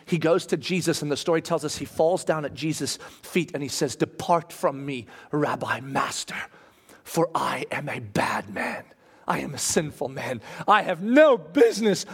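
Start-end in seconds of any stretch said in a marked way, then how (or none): 3.9-12.1 low, hushed, slow near-whisper; humbled confession. Retold or imitated. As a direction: confess it under your breath, slowly. Peter falls down, confessing to Jesus.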